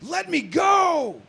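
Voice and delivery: high, slow desperate cry; desperate shout. Shown as a demonstration. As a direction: yell it out in desperation. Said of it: "we hear this person say".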